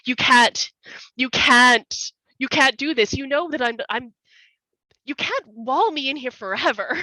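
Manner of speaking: loud, desperate pleading; sobbing plea. Acted. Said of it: "Valentine at this point is sobbing".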